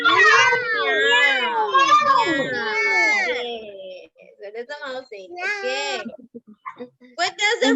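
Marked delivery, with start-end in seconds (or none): 0.0-1.7 high, loud drawn-out meow; cat meow. Shown as a demonstration. Teacher imitates cat sound.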